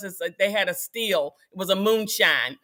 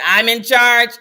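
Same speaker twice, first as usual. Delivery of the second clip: lightly mimicked loud declaration; loud imitation of a man asserting authority; father in charge; 7 dB louder than usual. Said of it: "He said".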